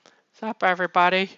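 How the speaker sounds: high, loud hammed-up greeting; mock cheery high-pitched character voice; simple goddess voice. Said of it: "She's like".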